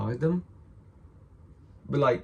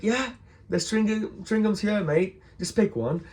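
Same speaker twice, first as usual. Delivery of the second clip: higher-pitched, knowing shopkeeper voice; amused shopkeeper; 8 semitones higher than usual. Retold or imitated. Shopkeeper who knew what he wanted, high amused reply. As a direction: play the shopkeeper humoring a kid. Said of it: "He started smiling".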